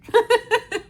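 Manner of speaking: short high-pitched laugh; a quick bright burst of laughter. Real, not acted. Tired laugh after saying she's sick.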